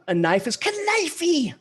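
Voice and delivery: "knifey" with the k sounded and a final ee, high and slow; every syllable sounded. Shown as a demonstration. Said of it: "they say every syllable".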